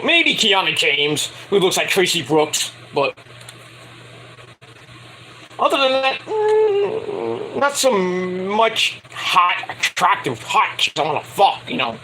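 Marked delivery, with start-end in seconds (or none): 6.2-7.6 high hum. Pretend.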